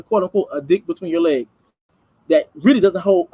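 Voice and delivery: normal talking voice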